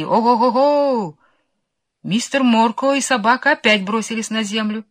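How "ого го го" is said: muffled distant call. A narrator's reading. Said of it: "раздались приглушённые крики".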